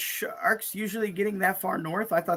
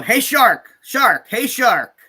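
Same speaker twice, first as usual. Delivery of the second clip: mock shout, higher and louder; mock yelling; 7 semitones higher, 8 dB louder, much slower than usual. Cohost imitates revelers calling to sharks, mock shouting. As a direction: call out to the shark like a beachgoer. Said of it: "They're just yelling".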